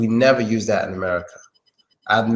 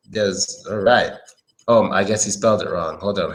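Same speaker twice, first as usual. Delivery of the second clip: ordinary delivery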